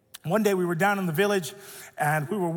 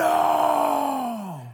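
mock child scream; 6 semitones higher, 5 dB louder than usual. Daughter refusing, screaming.

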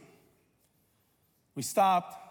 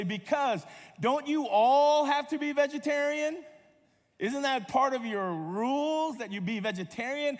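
probing stranger; 8 semitones higher, 3 dB louder than usual. Retold or imitated. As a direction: imitate someone pressing with a naive question. Strangers press him about church rules.

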